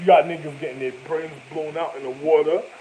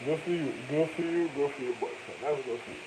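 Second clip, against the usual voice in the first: dismissive mutter; 6 dB quieter than usual. Real, not acted. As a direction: wave her off under your breath.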